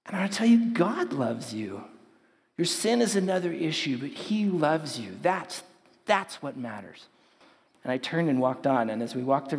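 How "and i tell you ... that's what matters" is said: retelling a gentle, slowed-down plea to a protester; compassionate plea. Retold or imitated. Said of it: "God just gave me this compassion".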